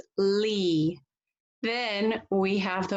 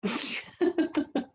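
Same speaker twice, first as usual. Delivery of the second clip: high giggle; 8 semitones higher than usual. Real, not acted. Teacher laughs at silly picture.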